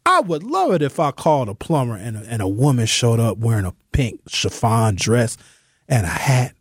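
normal talking voice

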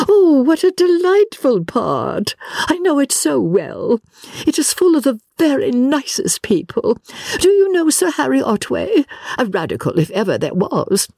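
very high, effusive delight; gushing society lady. Acted. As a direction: gush over shared acquaintances in a high voice. Miss Lavish gushes.

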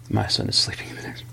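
unvoiced, fast whisper. Real, not acted. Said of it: "Sorry about this".

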